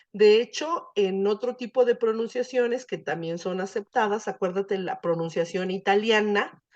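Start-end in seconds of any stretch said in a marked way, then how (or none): none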